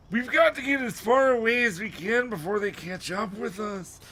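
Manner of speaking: high, urgent character voice; high-pitched worried old man; Mister Dawes. A narrator's reading. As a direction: put on a high voice, hurrying them away. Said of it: "Mister Dawes said".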